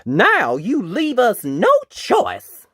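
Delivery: high, loud, slow declaration; knight's yell. A narrator's reading. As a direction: proclaim it as a defiant battle challenge. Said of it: "yelled the knight".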